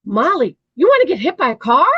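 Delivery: high, loud, fast excited call despite the words; same happy sing-song. Shown as a demonstration. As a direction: make a threat sound like a treat. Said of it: "the dog would jump and jump the same way".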